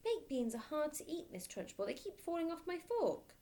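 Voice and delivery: put-on high, pleading little boy voice; small boy. Boy explaining himself to the headmistress, high and pleading. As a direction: plead like a nervous schoolboy.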